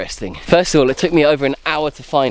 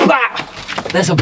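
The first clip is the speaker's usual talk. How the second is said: sudden high, startled exclamation; jolted by a surprise sighting; startled cry; 7 semitones higher, much slower than usual. Real, not acted. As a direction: blurt it out in real alarm. Startled by a bat.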